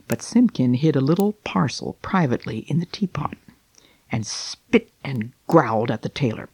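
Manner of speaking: gentle storybook reading, unhurried; story narration. A narrator's reading. Reading The Tailor of Gloucester to listeners, storybook narration. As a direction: read it like a bedtime tale. Reads Beatrix Potter aloud.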